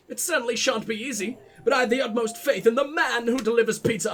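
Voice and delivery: theatrical posh letter-writer; lofty, posh formal delivery. Acted. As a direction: read it grandly in a posh accent. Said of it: "I gotta do the posh voice".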